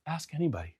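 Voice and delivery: very soft, unbothered reply; calm echo. Retold or imitated. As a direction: hand it back quietly, unruffled. His calm echo of the line.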